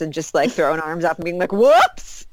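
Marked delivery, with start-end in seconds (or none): 1.5-2.3 high, loud fake oops; mock whoops. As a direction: yelp it like a guilty footballer. Mock player after own goal.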